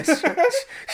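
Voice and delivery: ordinary talk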